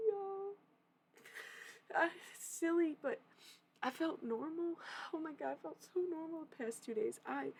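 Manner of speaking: high, giddy relief pouring out; excited gushing. Real, not acted. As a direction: squeal how good it felt to be normal again. Gushing about feeling normal.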